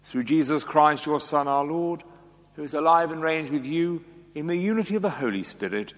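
ordinary talk

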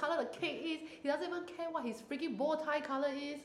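high whiny complaining fiancée; complaining girl. Retold or imitated. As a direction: whine like a fed-up bride. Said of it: "you know how girls always complain".

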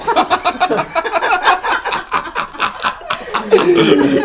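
a long high burst of laughter; high laughing fit. Real, not acted. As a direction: crack up completely at the interruption. Host cracks up at interruption. (gargalhada longa e aguda)

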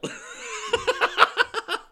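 loud, high burst of cackling; shrieking laugh. Real, not acted.

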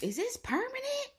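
very high, loud mock shriek; imitated alarmed mom. Mom questioning the face design, imitated high shriek. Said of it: "She was like".

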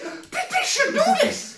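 high, slow laughing outcry; laughing shout. Retold or imitated. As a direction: laugh it out in disbelief. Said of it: "I said".

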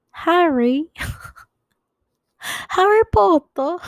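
very high, loud, drawn-out cry; Harry Potter imitation. Goofing around for listeners, high mock voice. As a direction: call the name out high and long. Sudden movie character imitation.

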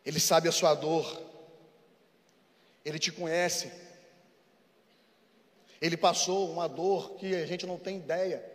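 soft, low, intimate preaching; pregação terna. Acted. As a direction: comfort the grieving listener gently. Quiet tender preaching. (pregação suave e íntima)

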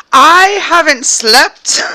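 slow high drawn-out, laughing. Acted. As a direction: stretch it out, cracking up. Joke repeated with bigger take.

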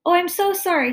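high, gushing apology; apologetic child. A narrator's reading. Daughter apologizing to guest she hit, contrite. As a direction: blurt out sorry in a child's pitch.

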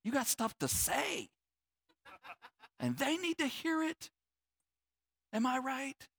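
high, hushed mock insistence; mock self-justifying. Voicing people with stuff to say, high-pitched insistence. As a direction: push the pitch up while keeping it very soft. Mimics people who let it all out.

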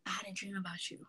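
soft, spooky whisper. Retold or imitated. Daughter imitating mom's eerie late call: hushed whisper. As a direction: breathe it like a secret. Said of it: "And I'm like".

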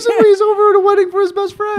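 high, loud laughing protest. Real, not acted. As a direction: say it through laughter. Laughing defence of Robert.

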